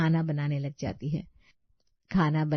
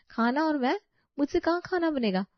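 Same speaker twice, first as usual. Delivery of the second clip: high, incredulous protest; mock shock; 9 semitones higher than usual. Daughter-in-law to mother-in-law, high incredulous protest. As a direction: act scandalised at the idea. Lazy bride shocked at cooking.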